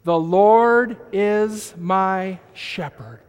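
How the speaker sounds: slow congregational recitation. Shown as a demonstration. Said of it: "Say it with me".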